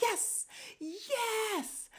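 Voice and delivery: very high, drawn-out gleeful cheer; squealed, savored delight; so happy. Retold or imitated. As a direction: celebrate in gleeful triumph. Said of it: "I was so happy".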